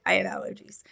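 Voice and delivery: whispered aside; hushed, half-joking aside. Real, not acted. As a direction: drop to a confiding whisper. Aside about not having covid.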